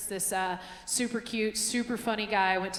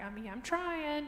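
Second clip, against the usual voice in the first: modest higher shrug; 7 semitones higher, 6 dB quieter, slower than usual. Retold or imitated. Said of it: "I was like".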